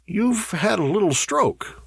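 high-pitched, falsely soothing lie; mendacious. A narrator's reading. Burford to the man coming round, mendacious. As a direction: lie to him kindly. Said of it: "said Burford mendaciously".

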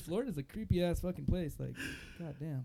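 ordinary talk, nothing marked

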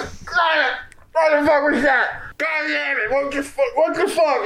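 high, panicked yelling; panicked shouting. Acted. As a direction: shriek it in sudden panic. NPC startled by intruder, shouting.

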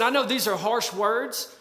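ordinary delivery

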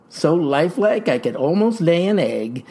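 normal talking voice

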